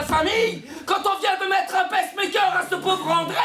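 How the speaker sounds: high, loud, relentless tirade; furious mother. Acted. Mother's furious tirade at her son. (mère furieuse, tirade aiguë)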